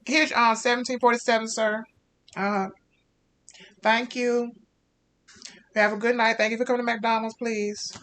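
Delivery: high, bright fast-food worker voice; drive-thru cashier wishing the customer goodnight; chirpy drive-thru cashier. Acted. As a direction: cheerfully hand over the order. Cashier Rebecca hands over food.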